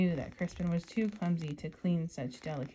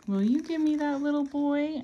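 higher, brighter rainbow voice; sparkly rainbow sing-song; 8 semitones higher, 9 dB louder than usual. Acted. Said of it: "asked the rainbow".

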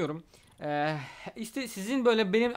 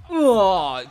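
surprised gasp; 10 dB louder than usual. Real, not acted. Surprised at own kick.

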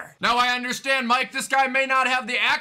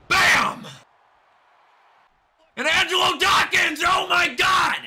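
high, shouted wrestling call; hyped announcer yelling in disbelief; astonished play-by-play; 10 semitones higher, 4 dB louder, slower than usual. Real, not acted. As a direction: lose it over a huge hit. Calling a big hit.

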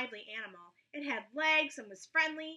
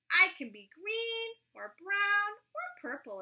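higher, louder boast; proud chameleon; 9 semitones higher, 5 dB louder than usual. A narrator's reading. Chameleon boasting to Roy, higher. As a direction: show off every colour, higher.

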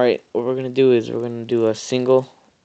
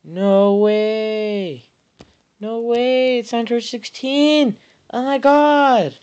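much higher, slowing stunned delight; dawning joy; 11 semitones higher, 4 dB louder, much slower than usual. Real, not acted. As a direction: let the joy sink in slowly. Pulls Android sixteen.

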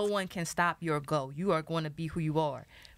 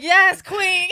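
high, loud hype call; hyping cheer; 12 semitones higher, 15 dB louder than usual. Retold or imitated. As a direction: cheer her on across the room. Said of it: "looking over his shoulder like".

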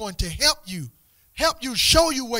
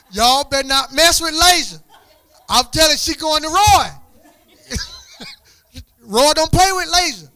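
laughing tease; 8 semitones higher, 8 dB louder than usual. Real, not acted. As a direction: laugh through a playful warning. Teasing the class about Lasia's dad.

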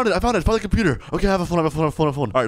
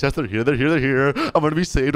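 ordinary talk

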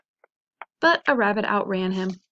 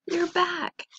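high, delighted greeting; excited welcome; 8 semitones higher than usual. A narrator's reading. Michelle greeting her returning dog, high excited welcome. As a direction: welcome them back in a high voice.

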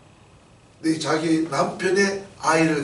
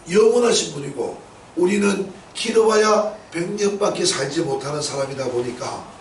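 ordinary talk

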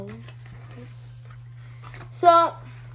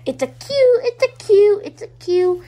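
squealed high and loud, easing off; overexcited squeal; excited gushing; 7 semitones higher, 7 dB louder than usual. Real, not acted. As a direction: gush over the cute bear, fading down. Gushing over cute toy bear.

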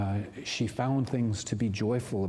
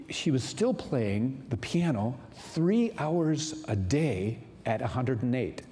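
ordinary talk, nothing marked

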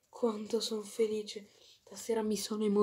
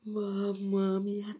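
ordinary delivery

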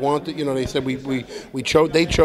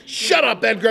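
mock Chloe snapping; 8 semitones higher than usual. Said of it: "She would always be like".

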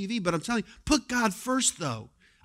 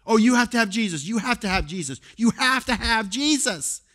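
mocking pushy Christians; 4 semitones higher, 5 dB louder than usual. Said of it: "what a hypocrite we are".

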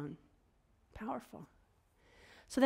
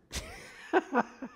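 bursts into a high laugh; amused high laugh; 11 semitones higher than usual. Real, not acted. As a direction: laugh at the joke. Laughs at own joke.

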